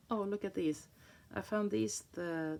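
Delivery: usual speaking voice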